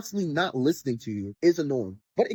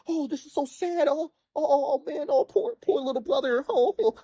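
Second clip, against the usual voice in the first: high, hammed-up fake sobbing sympathy; mock sadness; 9 semitones higher than usual. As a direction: overplay the grief sarcastically. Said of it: "where I'm like".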